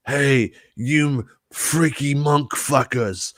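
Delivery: slow, mock-creepy come-on, lightly voiced; a hint of a leering bounty hunter; creepy Boba Fett impression. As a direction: drawl it out like a creep at the bathhouse. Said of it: "That's a pretty good impersonation".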